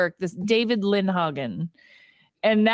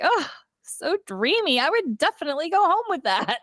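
swooning; 8 semitones higher than usual. Pretend. Swooning at Ryan Gosling.

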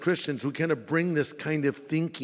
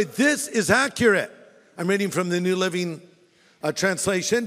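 ordinary talk

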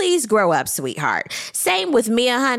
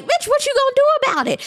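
much higher, fast mock challenge; a sassy high imitation of a castmate picking a fight; imitating Mia; faster than usual. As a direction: throw down the dare like you're squaring up. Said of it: "And Mia was like".

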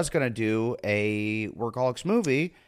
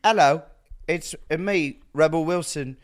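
put-on loud, higher botched Australian accent gone British; Rebel Wilson; 6 semitones higher, 6 dB louder than usual. Comedian impersonating co-star on request, wobbly British-Australian accent. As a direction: do a bad Aussie impression that lands English. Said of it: "to impersonate Rebel".

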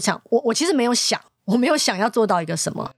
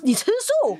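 surprised question; 13 semitones higher, slower than usual. Retold or imitated.

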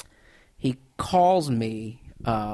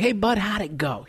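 excited; 6 semitones higher than usual. Retold or imitated. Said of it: "He's very excited".

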